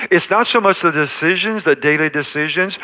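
ordinary delivery

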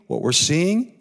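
usual speaking voice

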